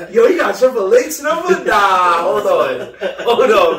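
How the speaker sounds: high, loud burst of excitement; hyped prank caller. Acted. Imagining himself finding Triple H's number, hyped and loud. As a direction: blurt it out, overexcited and loud.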